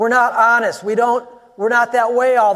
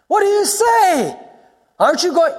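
high-pitched shouting accuser; mock yelling; 11 semitones higher, 4 dB louder than usual. Accusers demand that silent Jesus answer, acted yelling. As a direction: yell it like a hostile interrogator. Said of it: "they're yelling at him".

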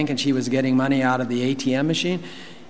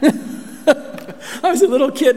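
laughing; 12 semitones higher, 3 dB louder, slower than usual. Real, not acted.

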